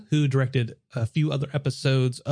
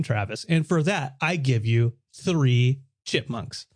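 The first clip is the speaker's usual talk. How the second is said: ordinary talk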